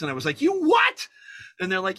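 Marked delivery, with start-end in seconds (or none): 0.4-1.1 high, loud disbelieving question; incredulous shout. Retold or imitated. Hearing the studio sent his script to Hanks, shouting back in disbelief.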